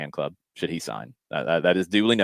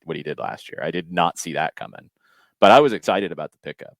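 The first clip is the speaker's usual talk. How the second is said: usual speaking voice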